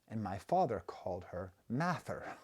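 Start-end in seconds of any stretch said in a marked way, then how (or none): 1.7-2.3 "Mather" with the R moved to the end; misplaced Boston R. Retold or imitated. Father saying aunt Martha's name, "Mather" with misplaced R. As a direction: drop the middle R of Martha, add one at the end: "Mather". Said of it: "took the R and put it".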